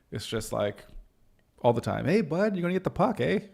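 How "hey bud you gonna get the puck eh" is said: higher, lively Canadian rink voice; hockey Canadian accent. Retold or imitated. Calling to a teammate at the rink, Canadian accent. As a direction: call out like a Canadian hockey buddy. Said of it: "spill out the old A".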